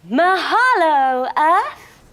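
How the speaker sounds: loud, slow, high call to the sky; joyful call. Pretend. Seals call thanks to the sun, loud and slow.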